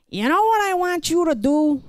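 high, coaxing father voice; dad's gentle question. Retold or imitated. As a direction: lean in and tease out a secret plan. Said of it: "And then he says to me".

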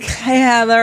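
high imitated Southern Valley Girl hello; Valley Girl-Southern accent. Showing the only words she knows, Valley Girl-Southern accent. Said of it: "It's the only words I know".

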